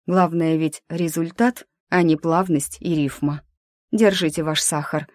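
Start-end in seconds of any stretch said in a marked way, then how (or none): none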